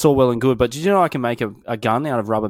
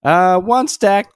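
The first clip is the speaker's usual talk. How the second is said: put-on kid voice; 7 semitones higher, 6 dB louder, much slower than usual. Voicing the kid in a story.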